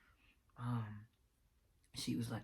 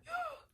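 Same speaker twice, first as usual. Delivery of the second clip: sharp high gasp for air; a sudden choking gasp; imitated gasp; 3 dB quieter than usual. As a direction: gasp like you can't breathe. Acting out sister's gasp for air.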